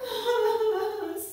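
high pretend sobs; play-acted weeping. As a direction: wail boo-hoo like an upset snake. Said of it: "started crying even louder".

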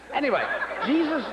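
ordinary delivery

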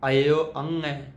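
ਅੰਗੈ said with a throat nasal ng before the ending; careful pronunciation. Shown as a demonstration. Teacher showing the sangat how to say ਅੰਗੈ, slow and clear. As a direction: say ਅਇਓ ਅੰਗੈ slowly, the ng from the throat. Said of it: "ਇਹਦਾ ਉਚਾਰਨ ਹੈ ਜੀ ਅੰਗੈ".

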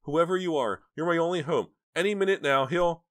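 high, loud frantic plea; terrified pleading. Acted. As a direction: plead for your life. Terrified woman on phone.

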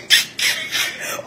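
wheezy, voiceless laugh; stifled laughter. Real, not acted. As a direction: burst into breathy giggles you cannot hold. Breaks up over her own line.

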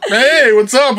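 lightly high, breezy hello; smooth greeting. Retold or imitated. Man greeting a stranger coolly, breezy and light. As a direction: toss off a smooth casual hey. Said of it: "He did it so smoothly".

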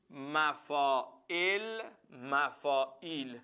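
very slow, word-by-word dictation; teacher dictating a rule for notes. Shown as a demonstration. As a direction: draw out each word for students to write. Teacher dictating note slowly.

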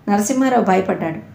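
ordinary delivery

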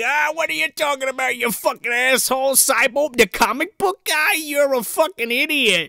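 high-pitched, exasperated put-on voice; acted-out indignant rant; mock angry scorn. Scornful reply in retold scene.

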